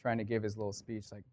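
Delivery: ordinary talk, nothing marked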